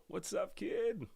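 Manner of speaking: normal talking voice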